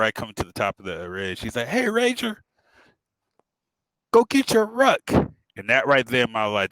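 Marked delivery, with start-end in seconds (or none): 1.7-5.0 high, loud imitated instructor's call; called-out order. Ranger instructor ordering trainee from the ridge, calling out. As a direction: call it down from far away.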